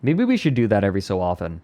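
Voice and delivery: usual speaking voice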